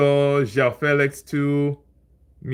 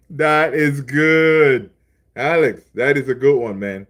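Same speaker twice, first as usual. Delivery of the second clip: delighted approval; 5 dB louder than usual. Real, not acted. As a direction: stretch the words out loudly, savouring the joke. Delighted by viewer's pun.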